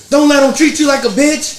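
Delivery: loud, high mock shout; egging him on. Passenger goading the driver from the car, loud high shout. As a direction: lean out and goad him. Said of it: "He's like".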